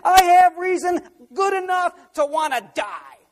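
high, loud preacher's fervour; preaching at full force. Real, not acted. Preacher driving home to the congregation, full force.

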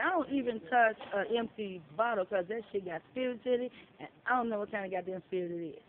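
ordinary talk, nothing marked

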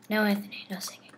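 whispered scolding. Real, not acted. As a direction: hush him softly. Hushing friend while muted.

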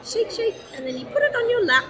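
high playful demo; teaching voice. Shown as a demonstration. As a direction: show the toddler how, sing-song. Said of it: "and you go like this".

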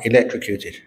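ordinary talk

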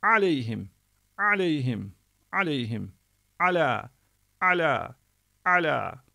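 "aleyhim" and "ala" with a throat-squeezed ayn; Arabic ayn in aleyhim and ala; ayn in words. Shown as a demonstration. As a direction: press the throat on the opening "a". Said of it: "bunu da böyle çıkartacağız".

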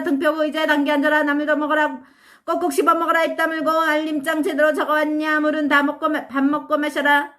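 a brisk, bossy stream of orders; nagging older brother. Acted. Older brother nagging the little boy nonstop, bossy. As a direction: rattle off chores like a strict big brother.

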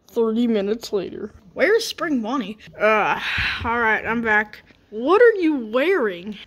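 ordinary talk, nothing marked

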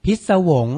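พิศวง said clearly and loudly as phit-sa-wong; pronunciation model. Shown as a demonstration. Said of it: "อ่านว่า".

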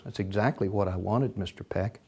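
very soft, low reply; hushed confirmation. Acted. Boss Ricks to Peck about the vase, hushed.

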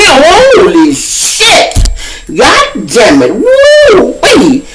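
shrieking very high, loud and stunned; freaking out. Retold or imitated. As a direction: lose it at the sight of the gun. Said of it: "man, he tweaking".

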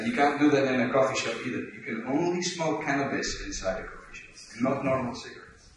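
ordinary talk, nothing marked

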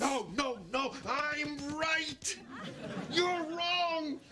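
high, drawn-out crowing; gloating triumph. Acted. Man wins the fish argument against his rival, gloating. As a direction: crow over the win, stretching the words high.

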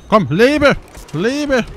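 loud, high plea; urgent shout. Real, not acted. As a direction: shout at a dying teammate to live. Urging teammate to survive. (lauter, hoher Ruf an den sterbenden Mitspieler)

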